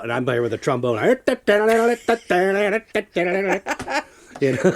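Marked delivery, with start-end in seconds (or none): 1.0-4.0 big laugh. Real, not acted. Laughs at own joke.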